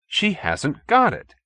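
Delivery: aggrieved. A narrator's reading. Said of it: "he said in aggrieved tones".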